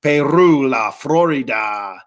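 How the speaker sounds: Peru and La Florida with Spanish vowels, lah flo-REE-dah; playful Spanish accent, La Florida with full open vowels. Retold or imitated.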